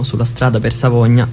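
ordinary talk

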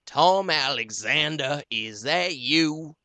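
loud, slow call from down a hole; hollering up out of the cistern. Acted. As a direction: Hank calls up to Tom from the cistern. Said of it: "he sings out".

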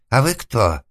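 high, loud, frightened question; tearful babble. A narrator's reading. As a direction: ask as if about to cry. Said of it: "лепетал затворник, готовый плакать". (испуганный лепет, чуть не плача)

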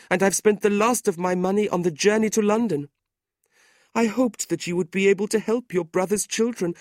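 high, plaintive woman's voice; sorrowful widow, pleading. A narrator's reading.

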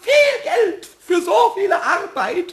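stamping high shout for money; indignant shout. Acted. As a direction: shout it like a stubborn child. Pumuckl demands pay for his work.

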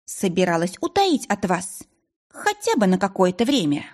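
plain reading voice

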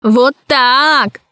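a touch of furious triumph; mild fury. A narrator's reading. Usurper gloats over the fallen empress, mildly furious. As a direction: spit it out in rage, understated. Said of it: "закричал в бешенстве узурпатор".

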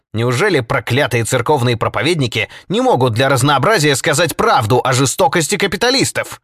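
loud, high, indignant tirade; angry exclamation. A narrator's reading. Jake rails against preachers and capitalists, loud and furious. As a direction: keep hammering out the outrage at full voice. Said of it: "восклицал Джейк".

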